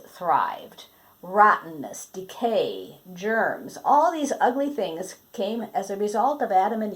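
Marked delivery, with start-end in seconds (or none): none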